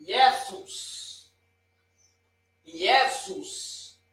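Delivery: Jesus said as ie-zus, slow, with an i glide; Jesus, ie-ZUS, no J sound; Greek pronunciation. Shown as a demonstration. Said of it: "a representação dele é o J".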